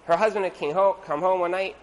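ordinary delivery

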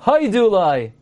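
"haidulai" with HAI stressed, falling through DU-LAI; stress on first syllable. Shown as a demonstration. Teacher repeating the corrected form, HAI stressed in "haidulai". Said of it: "you emphasize the first syllable".

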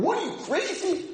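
mock scream at partner. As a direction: yell it at your study partner. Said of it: "I would scream at him".

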